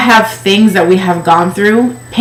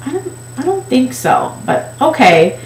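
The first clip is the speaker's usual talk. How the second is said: higher, softer doubtful mock clerk; skeptical clerk; 5 semitones higher, 5 dB quieter than usual. Clerk doubting the size, soft skeptical mock voice. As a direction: doubt it politely, then give in. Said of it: "she goes".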